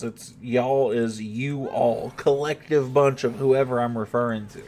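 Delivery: slowly spelling out y'all is you all; patient slow explanation; slow explaining. Shown as a demonstration. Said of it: "that's what it is".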